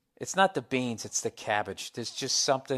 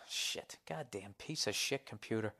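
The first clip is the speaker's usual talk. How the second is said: ordinary delivery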